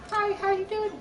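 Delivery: very high little put-on voice; smooth falsetto hello; smooth, sudden voice. Shown as a demonstration. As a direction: pop suddenly into a tiny high-pitched greeting. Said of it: "a smooth, sudden voice".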